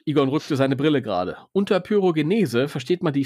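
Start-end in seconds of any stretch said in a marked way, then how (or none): none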